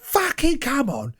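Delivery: slow Cockney "fucking come on"; a hard man in Cockney; Cockney accent. Shown as a demonstration. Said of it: "you've gotta do it with a Cockney accent".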